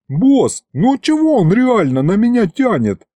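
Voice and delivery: high-pitched demon's protest; indignant. A narrator's reading. Demon appeals to his boss against the sorcerer, indignant. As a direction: complain to the boss about unfair treatment. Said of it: "возмутился Аристофан".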